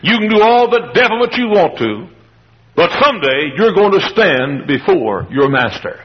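ordinary talk

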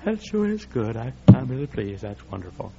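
mock John muttering fake delight; unimpressed John the Baptist. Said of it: "John the Baptist says".

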